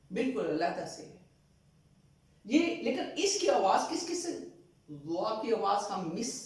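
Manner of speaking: usual speaking voice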